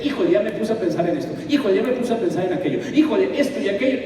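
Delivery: mock-fretful inner voice, hurried; quick muttered worries; rushed distracted thoughts. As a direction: rattle off the stray thoughts quickly. Imitating his own wandering thoughts.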